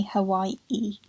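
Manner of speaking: "Hawaii" with a catch before the final ee; slow "Hawai'i" with a glottal break; stop in Hawaii. Shown as a demonstration. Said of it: "like, a sort of stop there".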